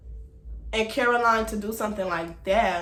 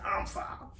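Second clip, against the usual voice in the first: breathy whisper of "I'm foul", no voice; unvoiced, sarcastic "I'm foul" under the breath; whispered aside; 10 dB quieter than usual. Sarcastic answer to Annie's insult.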